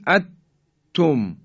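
اتم with the n swallowed into a doubled t; اتم with a stopped t held, then tum; wrong idgham. Shown as a demonstration. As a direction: say at-tum, loud, no nasal sound. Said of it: "حالت ادغامه و صحیح نیست".

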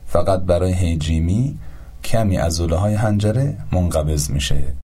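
ordinary talk, nothing marked

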